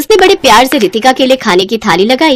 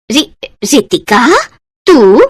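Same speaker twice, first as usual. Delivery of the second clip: shocked stammer; 2 semitones higher, much slower than usual. Acted. Babita shocked seeing Ritika.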